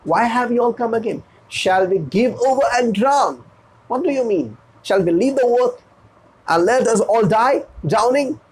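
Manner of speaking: high, strained voice of an exasperated boatswain; vexed boatswain. Acted. Boatswain scolds the frightened nobles, high and vexed. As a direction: snap at the nobles in a put-on high voice. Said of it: "looking at them, said".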